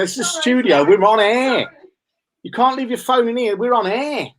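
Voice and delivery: high-pitched indignant protest; exasperated on-air scolding. Real, not acted. Scolds someone intruding in the studio.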